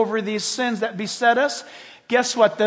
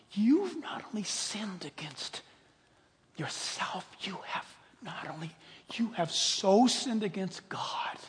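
accusing whisper; 7 dB quieter than usual. Acted. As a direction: condemn them, building from whisper to attack. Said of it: "You know what his next words are?".

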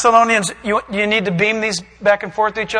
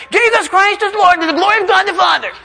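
high, silly mock confession; hammed-up insincere confession; mocking Satan; 9 semitones higher than usual. Shown as a demonstration. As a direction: recite it in a goofy high put-on voice. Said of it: "He's not gonna say it like that".

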